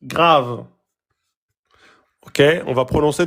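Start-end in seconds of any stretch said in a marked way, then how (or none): 0.0-0.6 deep emphatic grave. Shown as a demonstration. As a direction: say grave from the back of the mouth. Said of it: "de façon grave".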